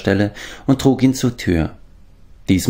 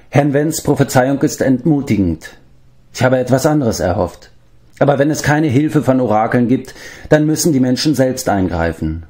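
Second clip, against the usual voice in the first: lightly voiced leader, higher than narration; determined leader; 6 semitones higher than usual. A narrator's reading. Gwydion to his companions after a discouraging prophecy, lightly voiced resolve. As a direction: address companions with sober resolve.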